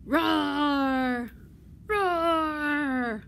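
two long, loud roars; dinosaur roaring. Acted. Librarian giving the dinosaur's reply to the cow, roaring. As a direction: answer with a big dinosaur roar. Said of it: "And the dinosaur says".

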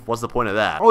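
ordinary talk

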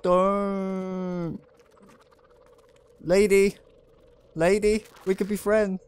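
frightened player pleading with the lady monster; nervous pleading. Real, not acted.

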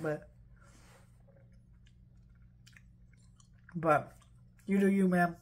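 talking while chewing; chewing candy. Real, not acted. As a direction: mouth partly full. Seen 0: Puts candy in mouth near 1.4s, then jaw and lips keep chewing.

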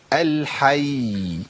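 الحي very slow, closing ya stretched out; lengthened final ya. Shown as a demonstration. Teacher showing how to lengthen when stopping, الحي with long ya. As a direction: hold the last y of الحي long. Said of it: "بس لازم أطول الزمن شوية".

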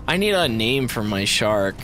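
usual speaking voice

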